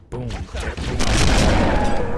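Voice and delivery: ordinary talk, nothing marked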